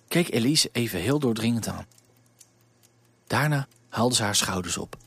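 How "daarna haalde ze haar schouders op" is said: usual speaking voice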